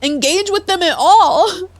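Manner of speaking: usual speaking voice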